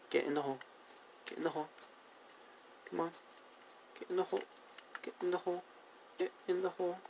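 very soft, repeated urging; hushed coaxing. Real, not acted. Player urging two cows into a hole, hushed coaxing. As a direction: coax them in, barely audible.

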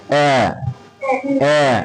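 অ্যা as a held, open "ae" vowel; like a crying baby. Shown as a demonstration. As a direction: demonstrate অ্যা like a baby's wail, drawn out. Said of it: "সেরকম sound আসবে".

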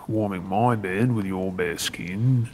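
usual speaking voice

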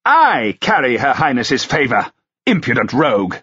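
scorn the upstart; indignant duke. A narrator's reading. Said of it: "his voice rising".

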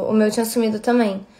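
ordinary delivery

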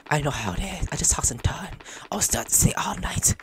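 hushed tired murmur; 1 soft whisper. Acted. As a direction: whisper like a sleepless crewmate. Voicing tired character quietly.

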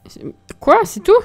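high sudden startled cry; startled what. Real, not acted. Startled by the game, a sudden high cry. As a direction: jump at the surprise.

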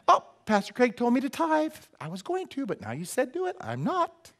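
pouty, high mock refusal; mocking stubborn churchgoer. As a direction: sulk like a contrary child.

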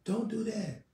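an urgent inner voice saying no; a hint of a distant divine call; voice of the Lord warning. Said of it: "the Lord shouting at you".

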